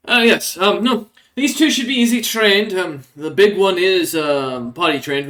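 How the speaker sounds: smug rat folk. Acted. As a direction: sell the captives like livestock. Rat folk appraising captives.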